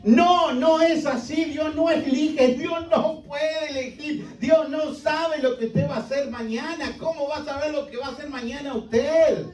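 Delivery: high-pitched parody of doubters; mocking objectors. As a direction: lift the pitch high and drag it out to mock their claim. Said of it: "denominaciones que dirían".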